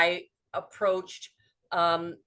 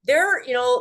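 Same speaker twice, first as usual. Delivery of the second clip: ordinary delivery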